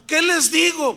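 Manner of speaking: loud, high-pitched desperate question; imitated panic. Pastor asking what to tell police, imitated panic. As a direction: blurt it out, mimicking a frantic caller. Panicked caller asks for help.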